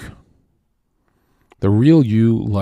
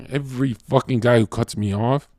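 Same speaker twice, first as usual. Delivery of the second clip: ordinary talk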